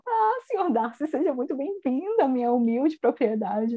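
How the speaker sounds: a hammed-up flattering hostess; fawning mother. Mrs Bennet welcomes Darcy to her humble home, fawning. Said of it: "ela tava um dia atrás falando".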